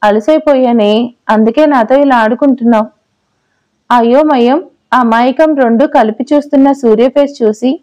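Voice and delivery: ordinary delivery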